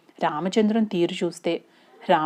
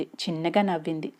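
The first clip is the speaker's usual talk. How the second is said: ordinary delivery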